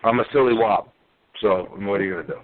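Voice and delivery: ordinary talk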